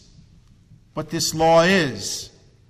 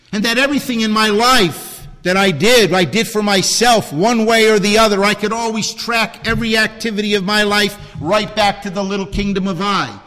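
higher and louder, pressing the point; fervent preaching; 5 semitones higher, 6 dB louder than usual. Acted. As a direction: preach it hard, building conviction. Preaching at full force.

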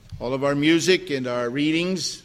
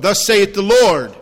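loud, raised ringing declaration of scripture; scripture proclamation; 4 semitones higher, 7 dB louder than usual. Acted. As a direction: proclaim the verse boldly to the congregation. Reads the Bible verse aloud.

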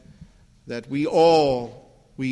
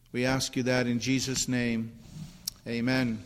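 hushed, lowered voice of prayer; congregational prayer; 3 semitones lower, 4 dB quieter than usual. Real, not acted. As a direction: pray softly on behalf of the congregation.

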